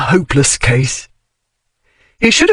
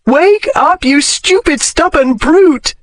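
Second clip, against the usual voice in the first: a high anguished yell at a stubborn brute; crying out; 12 semitones higher, slower than usual. A narrator's reading. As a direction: shake him awake with fury. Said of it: "she cried".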